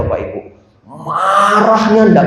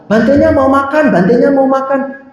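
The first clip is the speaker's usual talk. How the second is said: mock urgent adults; 8 semitones higher than usual. Adults urging the child.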